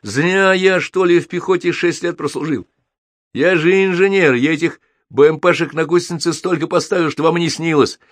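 high-pitched, worked-up bragging; indignant boast. A narrator's reading. Captain telling soldiers he is an engineer, high and indignant.

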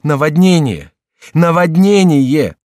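high, loud cry of alarm; desperate drawn-out shout. A narrator's reading. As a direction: shout the warning, drawing out the repeat. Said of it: "вскричал Генрих в отчаянии".

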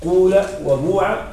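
slow قول وبوع with long oo; full u vowel in قول وبوع; pure damma. Shown as a demonstration. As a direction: round the lips fully on قول وبوع. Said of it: "وتضم الفاء ضمة".

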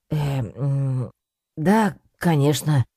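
hemming and hawing, unsure yes; hesitant mumble. A narrator's reading. Cat bluffing to Bartolomeo, mumbling hesitantly. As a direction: stall with filler sounds, then agree lamely. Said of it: "промямлил я".

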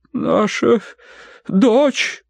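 high, halting invalid voice; faint, fluttering. A narrator's reading. Mother coming round from a faint, high and halting. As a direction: pause weakly between the words. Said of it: "проговорила миссис Меринг, трепеща ресницами".

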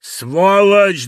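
a bellowed curse hurled from the crowd; bass curse. A narrator's reading. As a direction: bark the insult across the crowd. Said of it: "бас Трофимыча".

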